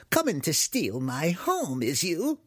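high, accusing batty wizard; crying out. Acted. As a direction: accuse intruders in a high, cranky voice. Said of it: "the human cried".

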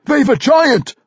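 very high urgent cry; alarmed warning. A narrator's reading. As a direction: yell a warning to comrades. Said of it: "warned Magnus".

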